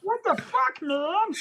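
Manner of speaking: high, loud comic cursing; put-on silly voice. Host cursing to make his girls laugh, high put-on voice. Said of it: "curse in that voice again".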